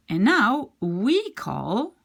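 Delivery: normal talking voice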